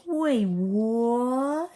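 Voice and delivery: loud, high, stretched-out question; shocked disbelief. Acted. Fighter seeing the station destroyed, loud shocked question. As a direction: cry out in mock shock.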